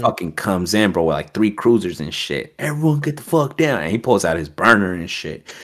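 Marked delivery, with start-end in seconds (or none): 2.6-3.8 higher-pitched mock command; retold cop threat. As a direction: order everyone down, imitating a cop. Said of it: "he's talking some hot shit".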